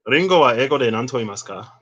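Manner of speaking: careful Japanese; Japanese question. Shown as a demonstration. Asking Siri the English for ringo, careful Japanese. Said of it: "Oh, wait".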